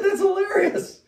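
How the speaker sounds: laughing disbelief. Retold or imitated. Laughing at the irony.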